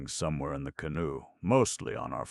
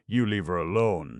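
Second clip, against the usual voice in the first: snapping; 5 semitones higher, 8 dB louder than usual. Acted. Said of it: "snapped Shirley".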